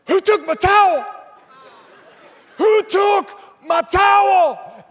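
high, loud mock yell of an old Cowboys fan; shouting it like a robbed grandpa; angry old fan. Old fan yelling at the crowd, high hammed-up outrage. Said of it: "he's like".

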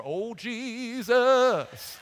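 long, drawn-out, high wail; hammed-up dread; mock-panicked prayer. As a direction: stretch the words into a comic cry for help. Acting pulled-over driver praying.

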